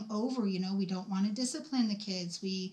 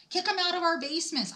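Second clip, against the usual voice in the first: high, loud rallying cry; 8 semitones higher, 4 dB louder, faster than usual. Real, not acted. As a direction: shout the mission. Rallying call.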